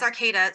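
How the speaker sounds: Arcata with a long "ay", ar-KAY-duh; correct local pronunciation. Shown as a demonstration. Guest confirming her hometown's name, Arcata as ar-KAY-duh. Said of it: "It's actually pronounced".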